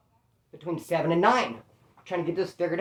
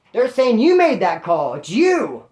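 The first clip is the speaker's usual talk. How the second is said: slow, louder, with "you" punched; retold accusation; 3 semitones higher, 6 dB louder, slower than usual. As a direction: lean hard on "you" to pin the blame.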